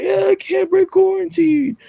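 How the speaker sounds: very high, slow put-on woman's voice; lightly mocking, prissy refusal; prim woman's voice. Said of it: "She's like".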